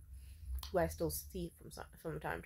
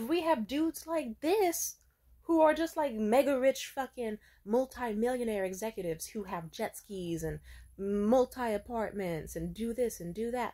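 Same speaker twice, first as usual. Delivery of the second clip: higher and louder, exasperated wailing complaint; mock jealous rant; 6 semitones higher, 6 dB louder than usual. Boyfriend Jared seeing her rich match, hammed-up exasperated rant. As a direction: whine at full volume, stretching the rich-guy list.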